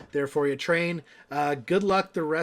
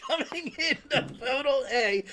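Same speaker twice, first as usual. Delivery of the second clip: laughing; 10 semitones higher than usual. Real, not acted. Laughing through question after heckle.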